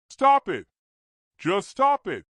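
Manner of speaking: loud, high shout; yelling. A narrator's reading. Shane in rage, yelling at Ashley to stop. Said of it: "he yelled".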